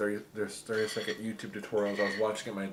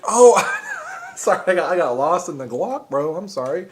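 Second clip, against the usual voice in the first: loud burst of real laughter; laughing; 8 semitones higher, 12 dB louder than usual. Real, not acted. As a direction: crack up mid-sentence. Laughing at pan of tortillas.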